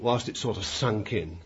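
ordinary talk

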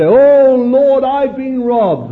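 high, loud anguished plea; crying out to God. Retold or imitated. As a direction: cry out to the Lord in distress.

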